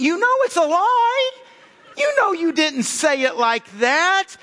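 high sickly-sweet put-on voice, slowing down; fake-sweet voice mocking how they claim it was said; syrupy mock. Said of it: "you add some stupid syrupy".

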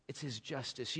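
hushed repeat; soft repeat. Shown as a demonstration. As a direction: let the words It's His justice sink in quietly. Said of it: "repeated softer".

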